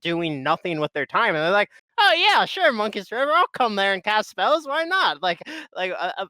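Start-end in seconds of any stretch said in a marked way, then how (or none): 2.0-5.2 high-pitched mock agreeable teammate; sing-out "sure, why not" compliance, put on; breezy agreement. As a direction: imitate someone cheerfully giving in. Acts out teammate suddenly agreeing.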